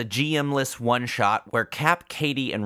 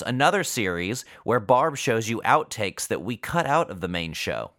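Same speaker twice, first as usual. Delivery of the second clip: ordinary talk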